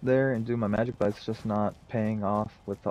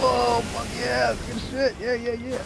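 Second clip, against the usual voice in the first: hyped gamer; 13 semitones higher than usual. Real, not acted. Landing big hits on boss.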